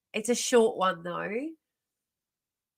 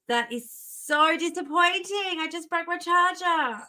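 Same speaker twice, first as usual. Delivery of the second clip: higher, whiny lament over a broken charger; mock-dramatic lament; 5 semitones higher than usual. Real, not acted. Streamer whining to viewers about the broken charger, mock despair. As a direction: ham up the disappointment with a wail.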